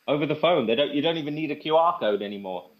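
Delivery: normal talking voice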